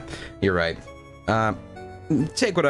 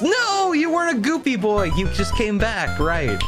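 high teasing voice; 12 semitones higher than usual. Acted. Teasing someone on stream.

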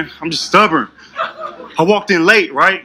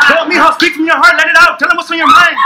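higher, rallying chant; egging on; 7 semitones higher than usual. Retold or imitated. Coworkers in a circle urging him on, chanting. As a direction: hype him up to speak out. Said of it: "this message was like".

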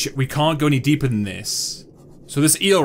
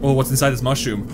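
ordinary talk

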